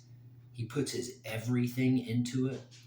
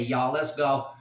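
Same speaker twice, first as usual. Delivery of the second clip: loud rally cry; hype shout; 3 semitones higher, 7 dB louder than usual. Real, not acted. Fan shouting support for Gaethje, loud hype. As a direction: punch it out to the crowd.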